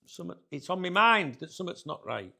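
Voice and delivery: loud. Real, not acted. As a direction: blurt out the worry loudly. Ominous repeated worry, now loud.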